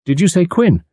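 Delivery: excited. A narrator's reading. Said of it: "he said excitedly".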